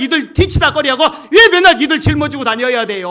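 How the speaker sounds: high, loud, indignant complaint; arguing, protesting. Acted. Preacher as Moses protesting to God about the people, loud aggrieved arguing. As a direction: argue it out at full voice, aggrieved. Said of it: "이렇게 따지는 거예요".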